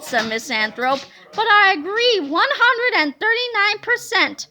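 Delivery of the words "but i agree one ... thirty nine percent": higher, louder, gushing yes-woman; eager agreement. A narrator's reading. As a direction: overeager lackey agreeing. Miss Anthrope eagerly agrees.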